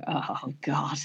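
hushed, sinking "oh no"; retold dread under the breath; whispered dismay in a retold moment. Dismayed aside after spilling the drink.

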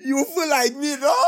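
high laughing read. Retold or imitated. Laughing while reading.

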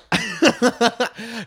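high-pitched chuckle; laugh. Real, not acted. Host laughs after the recipe remark, high chuckle. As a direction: laugh at your own joke.

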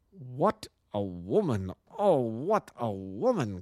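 the king marvels aloud at her, slowly; admiring cry. A narrator's reading. King cries out in awe of Irene Adler, drawn out. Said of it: "cried the King of Bohemia".

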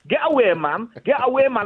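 high-pitched mock telling-off; acted indignant warning. Man telling a cheeky fox to get away, mock-indignant. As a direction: shoo off an intruder, pitch jumping up.

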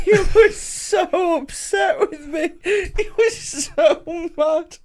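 high, slow, squeaking through laughter; laughing hard. Real, not acted. Teasing a friend about his anger, helpless laughter. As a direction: barely get the words out for laughing.

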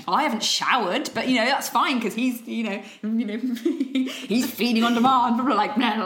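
loud, laughing gush; frazzled rant. Retold or imitated. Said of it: "like a real kind of rant".

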